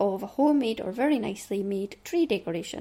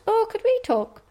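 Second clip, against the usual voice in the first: a hint of an eager child, raised voice; imitating the kids; 12 semitones higher, 6 dB louder than usual. Mother imitating her children asking to talk, lightly voiced. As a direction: pipe up like a keen kid asking a favour. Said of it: "they both asked".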